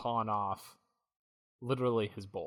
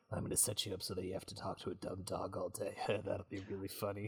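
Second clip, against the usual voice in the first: much softer, lower scheming aside; muttered aside; 3 semitones lower, 10 dB quieter than usual. Acted. Jay plotting a prank on Kay, muttered aside. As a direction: say it under your breath, to yourself.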